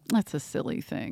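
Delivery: whispered aside; mock mom whisper. Mom dismissing the superstition, in a whisper. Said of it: "my mom was like".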